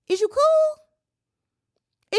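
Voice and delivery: very high, very slow, drawn-out "Is you cool"; put-on high disbelieving voice; incredulous squeal. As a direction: stretch it out in a high mock squeal. Incredulous question, repeated.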